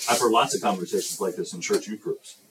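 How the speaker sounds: usual speaking voice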